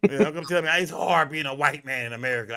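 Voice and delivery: normal talking voice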